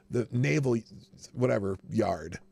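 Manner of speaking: ordinary talk, nothing marked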